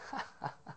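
soft, breathy acted chuckle; mock laugh kept low; God's chuckle. God laughing at old Abraham's baby, soft acted chuckle. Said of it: "And God's like".

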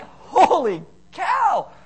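loud, slow, high Holy cow; mock alarm. Pastor acting his own shock at his wife, loud mock alarm. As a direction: stretch out the shock. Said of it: "I'm like".

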